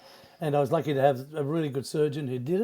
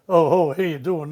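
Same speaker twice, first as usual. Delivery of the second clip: mock TV greeting; 7 dB louder than usual. Mimicking a style of TV greeting.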